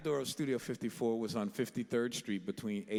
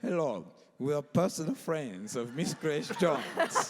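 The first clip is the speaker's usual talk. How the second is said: slow, louder, higher mock-diva voice; imitating the star's affectation; 5 semitones higher, 5 dB louder than usual. Young musicians announcing themselves to the doorman, affected imitation. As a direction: announce it grandly in a borrowed affectation. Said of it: "she wanted us to say it like".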